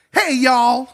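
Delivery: high, loud jump-scare greeting; Miss Minutes. Acted. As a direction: burst in with a shrill Southern-style holler. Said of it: "Miss Minutes".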